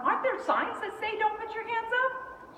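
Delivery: high, rising worried objection; alarmed protest. Retold or imitated. Mother protesting to daughter about the signs, alarmed.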